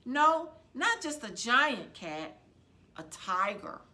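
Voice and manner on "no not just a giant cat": plain reading voice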